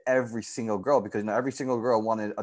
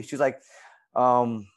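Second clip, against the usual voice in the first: ordinary talk, nothing marked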